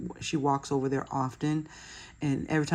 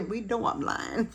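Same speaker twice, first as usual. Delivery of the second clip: playful high repeat; 7 semitones higher than usual. Real, not acted.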